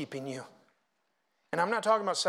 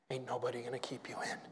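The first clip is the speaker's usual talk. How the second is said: softer, hushed warning; whisper; 9 semitones lower, 13 dB quieter, faster than usual. Real, not acted. Pastor warning his congregation in a low whisper. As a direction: lean in and whisper it.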